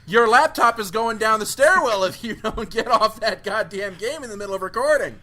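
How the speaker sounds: exasperated host telling someone off; mock angry threat. Host scolds co-host for gaming.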